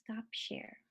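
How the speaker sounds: barely voiced whisper; whispered to self. Real, not acted. Presenter talking herself through the clicks, whispering. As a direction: whisper the command as you click.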